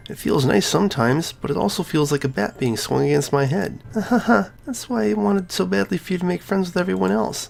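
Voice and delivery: put-on high girlish voice, hurt and pleading; a cheerful girl cracking open about her pain; Sayori's voice.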